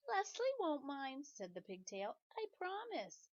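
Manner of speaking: high, slow, sweet promise; pigtail voice. Acted. Pigtail promising Paul, high and sweetly innocent. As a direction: promise it with wide-eyed innocence.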